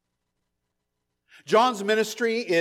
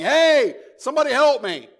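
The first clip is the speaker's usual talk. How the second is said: loud, higher shout for help; mock yell for help; 8 semitones higher, 7 dB louder than usual. Preacher mocks a lost man yelling, loud mock shout. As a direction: call out like someone lost in the woods. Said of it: "he's yelling".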